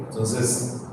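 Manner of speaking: normal talking voice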